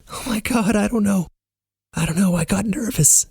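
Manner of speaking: high, flustered confession; giddy, nervous high voice; flustered nerves. A narrator's reading. Flustered, holding back laughter, confessing nerves.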